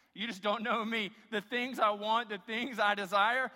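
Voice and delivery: mock greedy kid. Congregation's inward wants voiced as a kid at Christmas, mock greedy. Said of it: "like a kid at Christmas".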